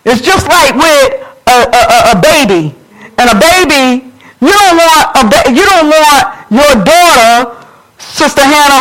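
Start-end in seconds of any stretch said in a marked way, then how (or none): none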